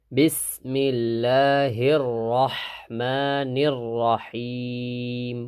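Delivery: রহিম with a deep throaty হা, রহমানির light. Shown as a demonstration. Teacher showing which হা sinks. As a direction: sink the second হা into the throat. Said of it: "এই হাটা ডাববে না".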